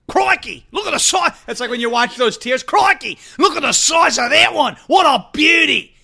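excited crocodile-hunter shout; hammed-up Aussie wildlife host; loud Australian ranger marveling; Australian accent.

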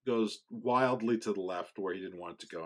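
ordinary delivery

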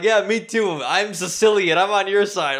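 high, loud over-eager placating; feigned agreement. Retold or imitated. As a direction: agree eagerly to appease her. Placating the crazy lady, retold.

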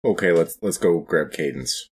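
ordinary talk

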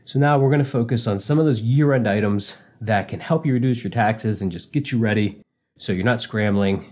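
normal talking voice

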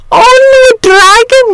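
very high, loud outraged echo; shrieked, indignant father's question; incredulous cry. A narrator's reading. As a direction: explode in disbelief at the casual remark. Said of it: "cried his father".

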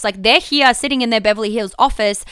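ordinary talk

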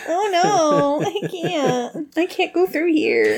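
high, slow frightened whine; whimpering coward. Retold or imitated. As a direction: whimper like a scared knight. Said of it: "he's gonna be like".

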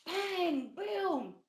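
high, drawn-out bang and boom; imitated crash noises. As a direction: voice the crash like sound effects.

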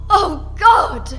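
high, loud cry of horror; horrified cry. Acted. Frankie crying out in horror at the cavern. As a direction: cry out at the sight.

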